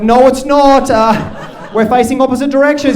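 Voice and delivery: loud, high, flustered protest; pleading his case. Retold or imitated. Dad defending himself to his son in the shower, loud pleading. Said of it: "had to plead my case".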